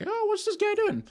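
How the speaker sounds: squeaky curious viewer; high-pitched newcomer voice. Retold or imitated. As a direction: pitch way up like a stranger dropping in. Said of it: "decides to come on the stream and be like".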